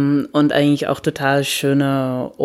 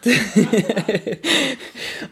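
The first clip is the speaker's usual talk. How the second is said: a high, bubbling real laugh; 9 semitones higher than usual. Real, not acted. To the host, laughing at how the Dutch were ahead, high laugh. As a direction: let the laugh spill out high and free.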